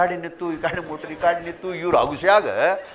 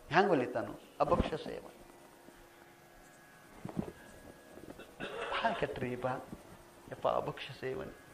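hushed; 13 dB quieter than usual. Acted. Preacher drops after the climax.

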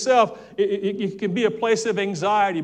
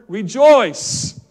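called-out word; 9 dB louder than usual. Real, not acted. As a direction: call out Rejoice loud and falling. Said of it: "What's the word?".

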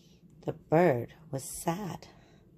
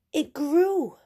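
amazed; 11 semitones higher, 4 dB louder than usual. A narrator's reading. Narrator marvels at growth.

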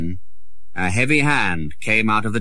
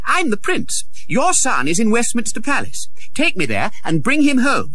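put-on high, firm young voice insisting; insistent boy prince; 14 semitones higher than usual. A narrator's reading. Prince ordering Canty to the palace, high and insistent. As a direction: command him to obey.

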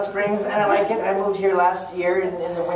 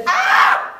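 very high, loud acted shriek; mock overwhelmed scream; 5 dB louder than usual. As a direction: shriek as if cuteness overload hit you. Overwhelmed by cute kid, mock scream.

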